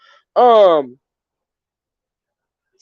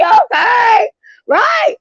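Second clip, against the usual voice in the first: squealed okay, far higher and louder; giddy laughing yelp; laughing high shout; 4 dB louder, much slower than usual. Real, not acted. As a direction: shriek it through laughter. Laughing at her reaction.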